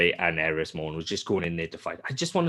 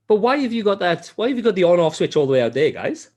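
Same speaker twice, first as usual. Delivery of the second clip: higher, louder, fast incredulous questioning; mock safety inspector; 9 semitones higher, 5 dB louder than usual. Safety guy questioning the Death Star design, incredulous mock inspector. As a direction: complain like a fussy inspector. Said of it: "a safety guy would come up and go".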